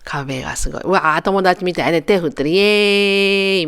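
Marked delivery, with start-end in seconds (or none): 2.5-3.7 slow drawn-out playful yeah; mock cheer. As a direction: stretch it out like a cheerful greeting. Mimicking friendly wave cheer.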